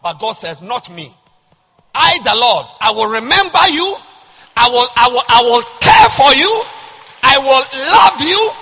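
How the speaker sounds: loud, high, building sermon shout; preaching. Acted. Pastor proclaiming God's words to congregation. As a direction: proclaim God's promise at full force. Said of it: "I'm preaching".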